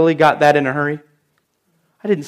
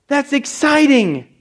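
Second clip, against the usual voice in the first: excited; 7 semitones higher, slower than usual. Shown as a demonstration.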